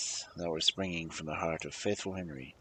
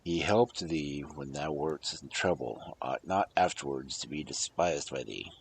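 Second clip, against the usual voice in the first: stern king; 6 semitones lower, 4 dB quieter than usual. Acted. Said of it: "the king grew angry and said".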